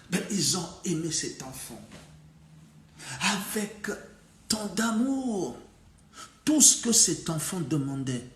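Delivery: lively folk-tale teller, punching each new event; storytelling for children. A narrator's reading. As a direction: tell it to little ones with big swings and pauses. Narrates the tale.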